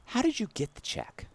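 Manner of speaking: a lightly voiced, suspicious clerk; teller. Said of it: "He goes".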